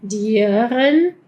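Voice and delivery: "Studieren" slow with a clear U; German studieren. Shown as a demonstration. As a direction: stretch "Studieren". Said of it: "Eso es una U".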